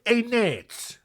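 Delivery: lightly put-on voice for a parolee husband; Philip impression. Parolee calling to his wife, lightly put-on character voice. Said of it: "I don't know why he talks like this".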